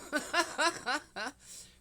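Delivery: high burst of laughter. Real, not acted. Laughing at memory.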